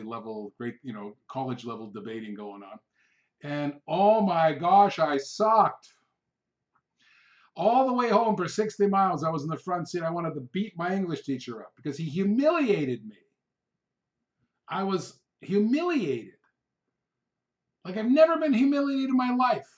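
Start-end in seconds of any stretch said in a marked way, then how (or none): 3.4-5.9 exasperated. Real, not acted. Recalling humiliating debate. 7.6-19.7 heated. Real, not acted. Recounting humiliation, getting heated.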